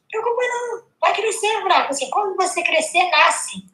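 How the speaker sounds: put-on high, reassuring child voice; imitating little girl. Little girl at the talk comforting the speaker, high child voice. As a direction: sound like a sweet four-year-old consoling. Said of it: "Aí ela assim".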